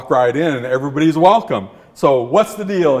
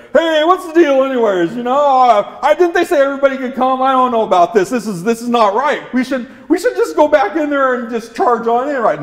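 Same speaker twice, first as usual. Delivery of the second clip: high-pitched grumbling dog voice; high dog voice stirring up the pack; 9 semitones higher than usual. Acted. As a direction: complain like an indignant cartoon dog. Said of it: "they were still grumbling".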